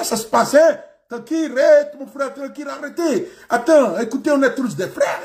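animated Senegalese French, pleading for calm; Senegalese accent. Acted. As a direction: play a Senegalese peacemaker, starting high. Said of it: "Le Sénégalais, quand il vient là, il te parle là". (accent sénégalais, conciliant et animé)

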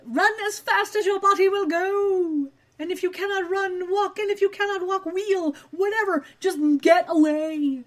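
mock urgent warning. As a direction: urge them away in a playful high wail.

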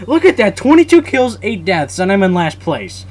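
high, loud disbelief; outraged complaint. Real, not acted. Gamer seeing his last-place score, outraged high complaint. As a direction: protest the injustice at full voice.

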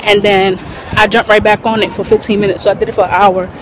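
ordinary talk

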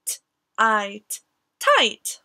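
segmented sounds then blended word. Shown as a demonstration. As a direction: sound out slowly then say it whole. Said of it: "sound-blending demo".

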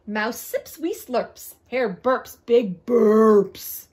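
lively, sing-song storybook reading; bouncy read-aloud with big pitch swings; story narration. A narrator's reading. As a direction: read a rhyming animal tale to children.

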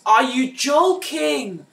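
slow, loud, then a high yelled no; furious disbelief. Real, not acted. Gamer killed again, shouts at the game in loud outrage. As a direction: drag out the outrage and explode on no.

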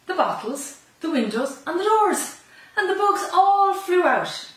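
joyful excitement. A narrator's reading. Joyful release of bugs.

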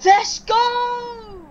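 high, loud, drawn-out cheer; real victory scream. Real, not acted. Gamer celebrating that he just won, screaming. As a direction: scream it out in triumph. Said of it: "I'm sorry I had to scream".

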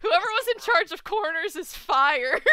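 laughing loudly through the words. Real, not acted. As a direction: crack up while saying it. Jokes amid group laughter.

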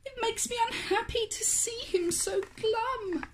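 high, soft mother's voice; a fretting mother sighing; worried mum. A narrator's reading. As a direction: sad caring mum. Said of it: "asked Dracula mum".

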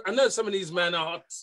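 normal talking voice

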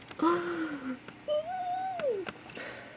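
drawn-out gasp of delight; long high gasp. Real, not acted. Fan gasping long at a new photo. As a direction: gasp sharply and hold it.

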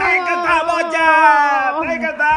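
very high, loud cheer; excited shout. Real, not acted. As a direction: shout it out in triumph. Found the hiding child.

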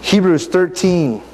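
ordinary delivery

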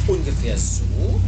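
slow, gently questioning bear; a hint of a motherly bear, drawn out; mother bear character. Mother bear answers little bird.

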